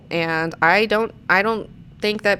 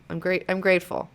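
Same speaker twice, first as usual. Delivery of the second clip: ordinary talk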